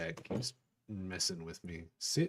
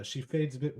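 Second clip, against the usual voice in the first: ordinary talk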